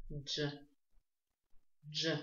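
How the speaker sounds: «дж» said with rounded lips. Shown as a demonstration. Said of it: "с округлением губ".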